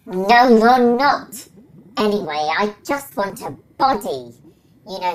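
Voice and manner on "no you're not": loud, slow denial. Acted. Brain loudly calls the carer a liar, slow and firm. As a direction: drag out a loud "no, you're not".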